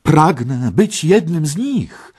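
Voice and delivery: high, loud, drawn-out exclamation; eager cry. A narrator's reading. Parsival cries out his wish to join them, high loud cry. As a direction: call it out with longing, slowly. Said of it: "zawołał Parsival".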